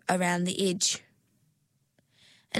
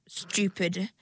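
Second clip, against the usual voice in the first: ordinary delivery, nothing marked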